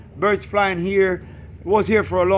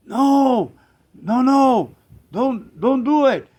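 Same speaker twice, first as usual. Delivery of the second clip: higher, drawn-out pleading no; alarmed objection; 4 semitones higher, slower than usual. Retold or imitated. Friend begs him not to release the birds, alarmed objection.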